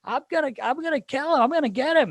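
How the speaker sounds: very high-pitched mock threat; squeaky impression of an indignant buddy; Phil Kessel. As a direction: insist in a comically high voice. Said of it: "And he goes".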